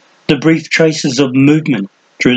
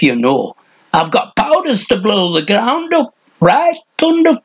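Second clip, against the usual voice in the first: put-on very high, shrill cracked old hermit ranting; crazed old man threatening someone in the brush; 9 semitones higher than usual. A narrator's reading. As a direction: rant and taunt like a mad old hermit.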